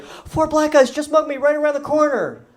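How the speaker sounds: high, frantic blurt to the cops; mock-panicked victim reporting the mugging; flustered report. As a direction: pitch way up like you just got robbed. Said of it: "I was very flustered".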